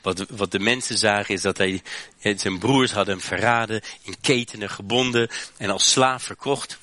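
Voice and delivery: ordinary talk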